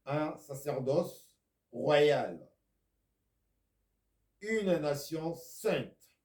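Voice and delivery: loud, slow, ringing scripture read; a preacher thundering the verse; forceful scripture preaching. Acted. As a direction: proclaim each phrase to the congregation. Reads scripture, full preaching force.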